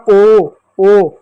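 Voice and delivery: ओ said as a held, emphatic "oh"; vowel ओ. Shown as a demonstration. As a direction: sound out ओ on its own. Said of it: "इसका उच्चारण". (ओ का साफ़ उच्चारण)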